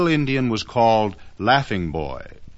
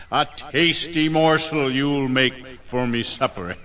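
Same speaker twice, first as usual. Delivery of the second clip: hungry storybook monster voice; menacing giant's gloat; 3 semitones higher than usual. Acted. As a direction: relish it like a giant villain. Giant gloats over captured boys.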